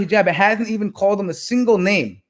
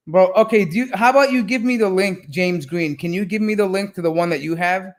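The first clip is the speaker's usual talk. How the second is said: ordinary talk, nothing marked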